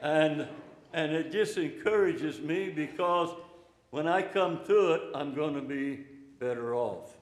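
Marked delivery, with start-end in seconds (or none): none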